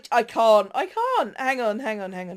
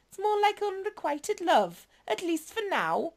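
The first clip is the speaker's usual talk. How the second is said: a hint of a teasing, higher voice; lightly voiced flirty villain; 8 semitones higher than usual. Flirty agent teasing the newcomer, lightly raised voice. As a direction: purr the compliments with a playful tease.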